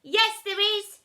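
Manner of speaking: loud jeering shout from the back; loud mocking call from the crowd; shouted heckle. A narrator's reading. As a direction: yell it out as a cheeky joker. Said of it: "shouted a joker from the back".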